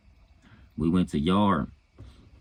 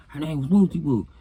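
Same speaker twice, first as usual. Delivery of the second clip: lightly voiced, pitched-up answer; high bragging inmate; 10 semitones higher, 3 dB louder than usual. Inmate from Trag New naming his girl, high casual answer. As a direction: toss the name out high and casual.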